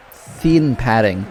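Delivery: usual speaking voice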